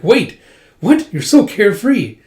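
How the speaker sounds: high, loud startled outburst; retold incredulous reaction; exasperated disbelief. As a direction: blurt it in mock outrage. Retold disbelief at sleeping drinker.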